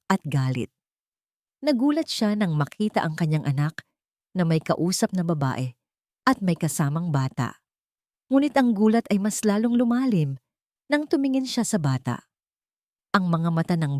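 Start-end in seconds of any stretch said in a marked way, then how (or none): none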